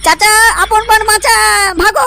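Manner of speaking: high-pitched yell while running away; fleeing cry. Acted. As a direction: call out high and run for it. Son flees from angry father. (পালাতে পালাতে উঁচু গলায় চিৎকার)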